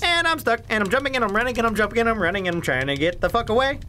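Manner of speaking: high, fast, run-on panicky narration; playful panic. Real, not acted. Player stuck while the spider approaches, high rapid panic. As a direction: rattle it off in a rising fluster.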